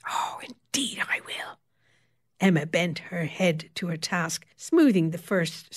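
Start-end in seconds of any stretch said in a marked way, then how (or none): 0.1-1.6 sly whispered promise; gleeful whisper. A narrator's reading. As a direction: savour it in a whisper. Gleeful revenge, whispered aside.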